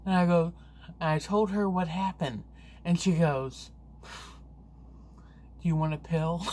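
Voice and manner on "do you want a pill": slow, sleepy half-awake question; slow drowsy wife. Retold or imitated. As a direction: drag it out like someone barely awake. Sleepy wife offering a pill.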